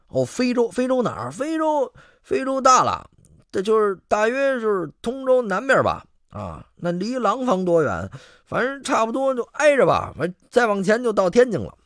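high, blustering con-man voice; blustering bluff. Acted. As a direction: bluff it out grandly. Rogue bluffing about Africa.

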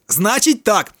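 high, loud outburst; bursting out. A narrator's reading. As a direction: explode at him. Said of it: "розриваються рекламники".

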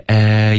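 "a" stretched from eh into ee; gliding diphthong. Shown as a demonstration. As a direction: draw out "a" as a sliding ay. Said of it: "有一个滑动". (“a”拉长滑动成双元音)

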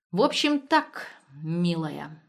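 с расстановкой, спадая к обращению; проговорить размеренно, с нажимом в начале; медленно. A narrator's reading. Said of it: "медленно проговорил Дементьев". (медленно, веско)

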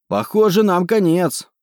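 high, loud desperate cry; shouting. A narrator's reading. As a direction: call out over the chaos. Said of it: "крикнул я Марике".

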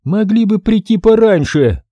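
высокий голос брюнетки, с укором; упрекни за опоздание высоким голосом; укоризненно. Acted. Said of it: "укоризненно заявила статная брюнетка". (брюнетка укоряет мастера высоким голосом)

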